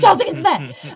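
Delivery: high, loud thrilled cry; awed exclamation. Retold or imitated. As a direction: burst out as if he's right beside you. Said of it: "and you go".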